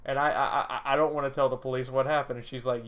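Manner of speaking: ordinary talk, nothing marked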